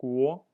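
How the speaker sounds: "Guo" loud with a rising second tone. Shown as a demonstration. Teacher modeling Chinese for learners, loud rising tone. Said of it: "the rising tone".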